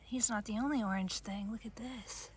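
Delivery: soft breathy whisper. Real, not acted. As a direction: hush your voice in wonder. Whispers over tiny finds.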